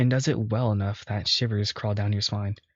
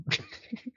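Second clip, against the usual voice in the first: short airy burst of laughter; sudden giggle at the line; high breathy laugh; 8 dB quieter than usual. Real, not acted. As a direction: laugh out in disbelief. Reacting to Papa Bear line.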